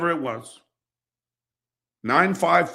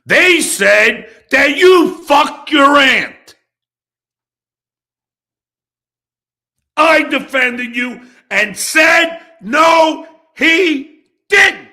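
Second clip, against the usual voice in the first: furious yelling; 9 semitones higher, 8 dB louder than usual. Real, not acted. Reminding Rob how he defended him, furious shout. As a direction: shout it out, outraged.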